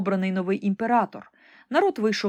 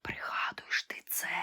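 ghostly whisper; 12 dB quieter than usual. A narrator's reading. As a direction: whisper like a haunting memory. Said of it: "шепотіли одне за одним". (моторошний шепіт)

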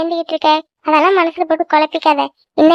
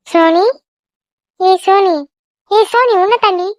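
calling out; 2 semitones higher than usual. Acted. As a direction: keep raising your voice to snap her back. Friend calls distracted Soni repeatedly.

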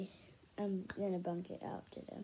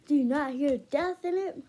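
loud outburst; 6 semitones higher, 10 dB louder than usual. Real, not acted. Indignant outburst to viewers.

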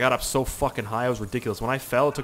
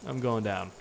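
ordinary delivery

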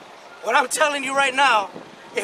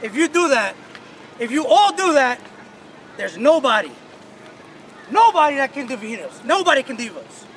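pep speech; 2 semitones higher than usual. Real, not acted. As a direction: fire them up. Coach firing up team.

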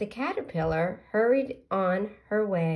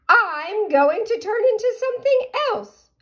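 caterpillar voice; 8 dB louder than usual. Acted. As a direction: draw it out high and proud. Caterpillar tells pollywog again.

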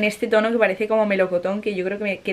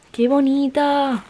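admiring; 5 semitones higher, much slower than usual. Real, not acted. Admiring an eyeshadow.

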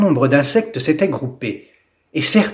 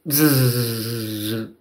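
long low humming buzz; 4 semitones lower, 6 dB quieter than usual. Acted. Dragonfly lifting off with Lila, low buzzing. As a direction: hum like wings beating.

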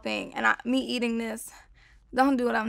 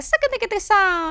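put-on higher, louder quote of a young girl; an imitation of the girl from a Vine, pitched up; repeating a girl's line from a Vine, pitched up; imitating a girl; 9 semitones higher, 3 dB louder than usual. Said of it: "She was like".

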